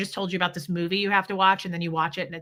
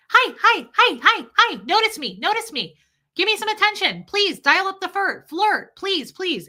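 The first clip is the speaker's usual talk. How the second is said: much higher, louder, wheedling plea for attention; needy pleading; 10 semitones higher, 6 dB louder than usual. Retold or imitated. Wife begging husband Tommy to notice her, needy whine. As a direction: beg to be noticed, hammed-up and clingy.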